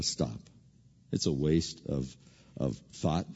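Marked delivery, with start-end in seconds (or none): 0.0-0.4 flat stop. Retold or imitated. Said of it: "Paul in Philippians says".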